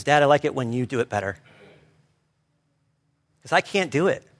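usual speaking voice

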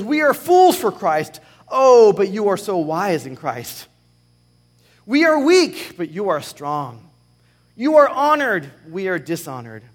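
mock the proud with each pair; sarcastic contrast. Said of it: "he's being very sarcastic here".

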